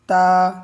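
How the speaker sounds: loud clear তা for learners; Arabic letter ta. Shown as a demonstration. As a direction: model তা crisply for students. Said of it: "জিহ্বার আগা উপরের দাঁতের গোড়ার দিকে গিয়ে লাগবে".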